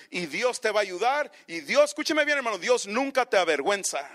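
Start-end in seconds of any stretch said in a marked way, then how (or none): none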